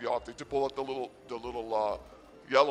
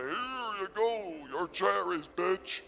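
tough-guy voice; 5 semitones higher, slower than usual. Retold or imitated. Imagined sword-stab line.

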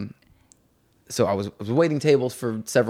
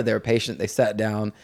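ordinary talk, nothing marked

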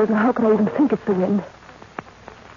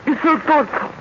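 "You're so thoughtful" lifted higher, stress on "so"; moved; 7 semitones higher than usual. Acted. Jane repeating her thanks to Edward, more moved. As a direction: repeat it with rising feeling.

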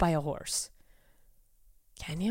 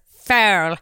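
Foul said as a clipped British "fair"; British accent; 4 dB louder than usual. Retold or imitated. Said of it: "British pronunciation and it just goes".

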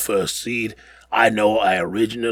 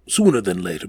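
normal talking voice

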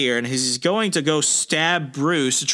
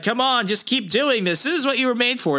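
high, goading taunt; egging him on from the ground; taunting villain; 9 semitones higher than usual. Retold or imitated. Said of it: "Meek is like".

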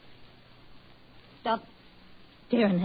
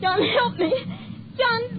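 panicked plea; 13 semitones higher than usual. Acted. Wife begs for help, panicking.

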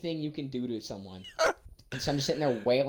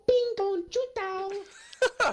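loud, very high and slow gibberish; mock rant; 6 dB louder, much slower than usual. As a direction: blurt nonsense like a tantrum. Imagined rant at old man.

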